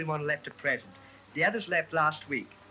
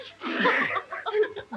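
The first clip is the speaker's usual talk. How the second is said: nervous giggle. Acted.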